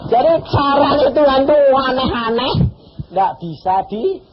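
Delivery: preacher raising his voice to the congregation; preaching out. Real, not acted. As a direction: proclaim it to the whole church.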